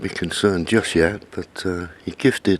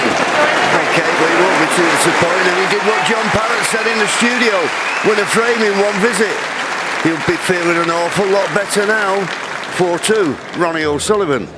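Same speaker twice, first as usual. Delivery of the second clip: excited commentary; 11 semitones higher, 3 dB louder than usual. Real, not acted. Raised commentary after frame win.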